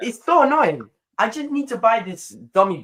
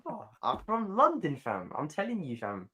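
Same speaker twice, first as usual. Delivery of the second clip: hammed-up London street talk, trailing softer; put-on London accent; 5 semitones higher, 6 dB quieter than usual. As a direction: play up the London slang. Hamming up London accent in reply.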